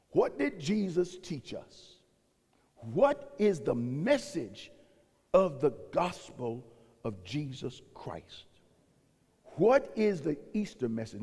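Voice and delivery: slow, deliberate, weighty; slow solemn question. Acted. Preacher asking his church about the gospel, slowly and solemnly. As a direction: let each phrase land slowly.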